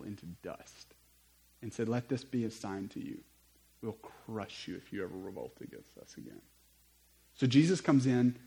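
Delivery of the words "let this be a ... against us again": low, soft warning; quiet menace. Acted. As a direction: drop low and soft for the threat. Romans' warning after destroying the temple.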